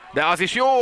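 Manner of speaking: sudden high, loud shout; excited sports commentary. Real, not acted. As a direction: burst of excitement at the play. Live call of a goal chance.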